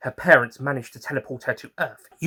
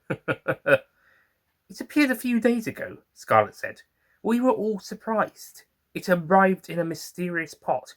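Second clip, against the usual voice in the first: Scarlet's higher voice; 8 semitones higher than usual. Acted.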